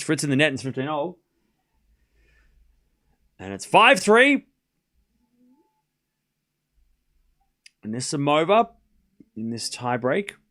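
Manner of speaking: live tennis commentary, rising high on big points; play-by-play call. Acted. Streamer calling the tiebreak for chat, live play-by-play. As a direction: narrate every shot as it lands.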